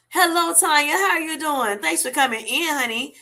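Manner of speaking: high sing-song welcome; excited greeting. Real, not acted. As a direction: lift the voice high, delighted to see her. Greeting a viewer arriving in chat.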